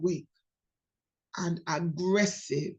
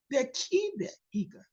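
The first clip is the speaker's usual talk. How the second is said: usual speaking voice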